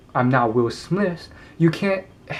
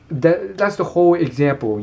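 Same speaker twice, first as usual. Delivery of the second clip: ordinary talk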